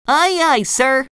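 high, eager cartoon voice; SpongeBob. A narrator's reading. Fry cook dashing off on boss's orders, eager. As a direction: answer eagerly in a high squeak.

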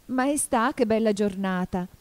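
usual speaking voice

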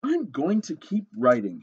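a touch of stubborn pluck; determined hen. Acted. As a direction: insist as a plucky storybook hen. Hen insists despite bad review.

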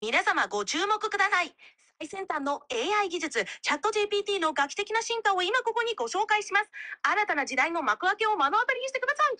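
very fast, high Japanese announcer patter; rapid-fire. Shown as a demonstration. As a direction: rattle it off at double speed.

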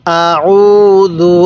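আউযু very slow, middle vowel held level; one-alif elongation. Shown as a demonstration. Teacher asking learner to repeat along, drawn-out আউযু. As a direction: chant আউযু slowly, stretching the উ. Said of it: "এরকমভাবে শিখব".

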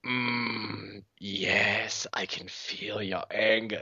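low, slow sinister rasp; creepy old Emperor voice; Emperor impression. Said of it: "when he's like".

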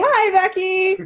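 high, slow sing-song hello; cheery drawn-out greeting. Real, not acted. Greeting friend on live chat.

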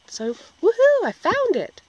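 high, loud happy cheer; excited cheer. Real, not acted. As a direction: cheer out the good news. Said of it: "I am so excited".

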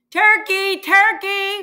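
loud, high sing-song sweet calling; sweetest voice. A narrator's reading. Mister Moose luring Turkey from his nest, sweet calling. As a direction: coax him out with a sugary call. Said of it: "in his sweetest voice".

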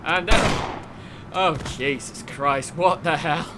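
ordinary delivery

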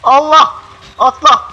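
sudden loud panicked cry; startled yell. Real, not acted. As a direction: yell in real fright. Startled by monster in game. (ani korkuyla bağırış)

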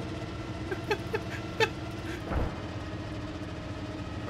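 high, airy pancake giggle; a breathless squashed little laugh; squeaky mock laugh. Crushed under the tank, laughing to his teammate in a high whispery giggle.

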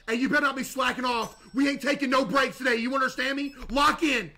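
hammed-up strict manager; mock boss scolding. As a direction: crack the whip on a lazy worker.

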